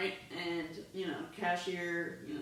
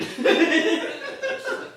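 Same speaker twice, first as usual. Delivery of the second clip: laughing out loud, higher and louder; cracking up so hard she can't go on; real laughter; 8 semitones higher, 12 dB louder than usual. Real, not acted. Cracking up mid-story.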